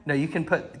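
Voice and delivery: usual speaking voice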